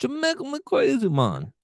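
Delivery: high, badly faked Jamaican lilt; mock Jamaican accent. Co-host punning on accents turning Jamaican, hammed-up high Jamaican accent. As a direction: sing out a silly Jamaican accent. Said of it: "accents eventually sound Jamaican".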